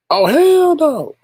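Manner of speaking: high, slow, loud outraged drawl; hell no. Retold or imitated. Streamer reacting to a situation, slow high outraged drawl. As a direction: stretch it out in outraged refusal. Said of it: "It's just like".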